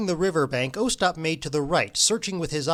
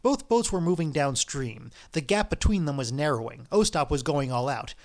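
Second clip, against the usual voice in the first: ordinary delivery, nothing marked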